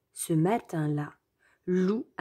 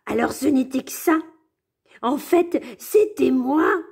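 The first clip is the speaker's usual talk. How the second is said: higher, louder relieved cheer; pleased; 6 semitones higher, 5 dB louder than usual. Acted. Wolf tells his friends happily it was him all along. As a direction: beam with relief. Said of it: "il dit tout content".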